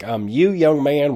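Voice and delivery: put-on light Southern accent, calling him out. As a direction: lightly imitate a Southern judge. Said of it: "with a Southern accent".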